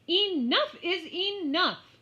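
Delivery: high, loud, slow scream. Acted. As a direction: scream it out, at the end of your rope. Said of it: "she screamed".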